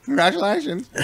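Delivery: put-on high, soft old-woman voice. Repeating the old woman's congratulations, high and soft. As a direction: imitate her high, quiet well-wishing. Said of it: "that's what she said".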